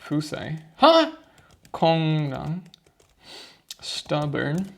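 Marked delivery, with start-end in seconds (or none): 0.8-1.1 surprised huh. Real, not acted. Confused by the answer.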